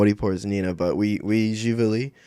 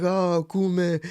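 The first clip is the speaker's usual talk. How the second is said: much higher greeting voice of her mom; imitating her mom; 10 semitones higher than usual. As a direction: greet him like his mother-in-law would. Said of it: "she comes in and she's like".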